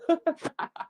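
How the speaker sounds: short high laugh. Real, not acted. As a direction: burst into a brief high laugh. Laughs at own remark.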